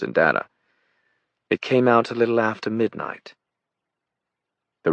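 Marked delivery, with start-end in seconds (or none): 1.5-3.3 high aristocratic British voice; composed British explanation, pitched up; British accent. A narrator's reading. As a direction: finish the point in upper-class British. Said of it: "in her British accent".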